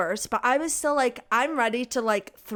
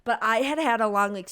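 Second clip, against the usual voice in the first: usual speaking voice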